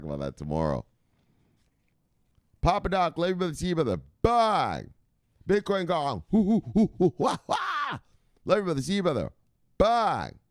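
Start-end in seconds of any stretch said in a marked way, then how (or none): 2.6-6.2 high excited farewell call; hammed-up sign-off. Streamer saying goodbye to chatters, high and bouncy. 6.3-8.0 high hooting ape calls; Kong voice. Acted. Streamer doing his Kong voice for chat, high hoots. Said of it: "my Kong voice". 8.4-10.4 high excited farewell call; hammed-up sign-off. Streamer saying goodbye to chatters, high and bouncy.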